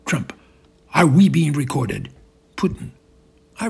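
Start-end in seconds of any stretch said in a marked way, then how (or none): 0.9-2.1 loud, contained fuming; controlled rage. Acted. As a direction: hold back the rage, but push the words. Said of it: "Fuming, yet controlling his rage".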